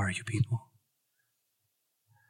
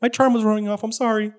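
higher, rising apologetic put-on voice; lightly voiced fluster; a hint of a flustered apology; Jarvis voice; 9 semitones higher than usual. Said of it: "He's like".

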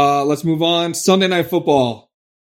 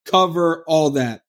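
normal talking voice

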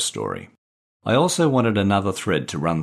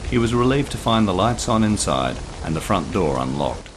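usual speaking voice

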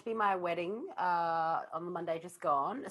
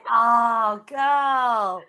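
excited shout; 5 semitones higher, 14 dB louder than usual. Real, not acted. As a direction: burst out with delight. Excited exclamation, very loud.

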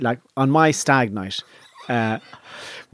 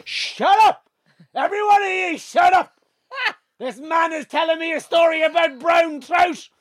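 high-pitched roar for silence; full-voiced, much higher imitation of a roar. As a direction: bellow a threat at the whole room. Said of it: "Hector roars out".

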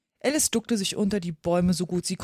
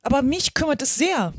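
higher, prompt protest; hasty reply; 6 semitones higher, 5 dB louder than usual. A narrator's reading. Alice hastily answering the pigeon, protesting. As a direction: jump in with a quick objection. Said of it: "sagte Alice schnell".

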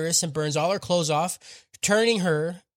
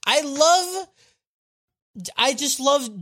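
LOVE pitched way up, stretched out; gushing emphasis; 8 semitones higher, much slower than usual. Real, not acted. Praising the superhero name, high gushing emphasis. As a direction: gush "I love" high on a held LOVE.

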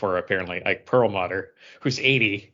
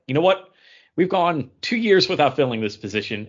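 mock company announcement; 5 semitones higher, 6 dB louder than usual.

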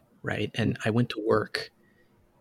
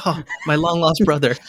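mock joyful reunion; 5 semitones higher, 5 dB louder than usual. Imagined embrace of fellow fan.

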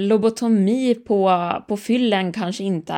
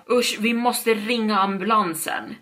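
ordinary delivery, nothing marked

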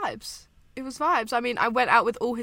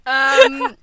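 laughing apology; 2 semitones higher, 11 dB louder, much slower than usual. Real, not acted.

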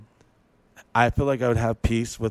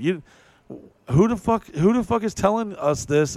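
indignant outburst; 9 semitones higher than usual. Real, not acted. Podcaster challenging who dictates how people live, indignant. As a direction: throw the question out in sharp indignation.

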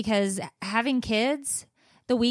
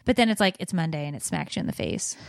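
ordinary talk, nothing marked

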